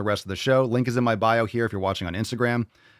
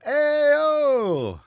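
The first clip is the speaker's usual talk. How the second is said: long, high, loud whoop; celebratory holler; 5 dB louder than usual. Real, not acted. Host cheering a fan's accent compliment, celebratory holler. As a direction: holler it high and let it drop at the end.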